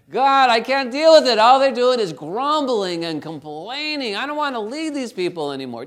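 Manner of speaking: loud, exasperated plea to God; exasperated Moses. Acted. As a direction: voice a worn-out leader who has had enough.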